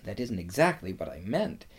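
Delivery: quick, hushed aside; mild whisper. A narrator's reading. Susan privately to Lucy about Aslan, understated whisper. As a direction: say it softly and fast, as a confidential aside. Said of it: "whispered Susan to Lucy".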